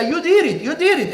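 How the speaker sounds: high childlike accusing voice, lightly played; a little girl repeating her accusation; girl accusing in English. Girl accusing the deacon in front of the pastor, high childlike voice. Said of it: "Pero la niña decía".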